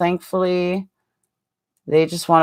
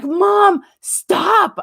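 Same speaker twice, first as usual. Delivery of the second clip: whiny embarrassed daughter; 12 semitones higher than usual. Retold or imitated. Daughter embarrassed by mom's joke, high whiny mock plea. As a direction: play the mortified daughter begging mom to quit. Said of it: "She's like".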